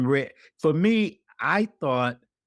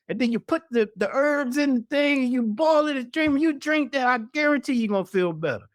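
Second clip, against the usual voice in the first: higher, fast and insistent, pushing the tea; eager advice; 11 semitones higher than usual. Retold or imitated. Corey urges the widow in pain to buy tea, excited and high. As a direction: sell her on the tea like a believer.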